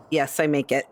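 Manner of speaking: usual speaking voice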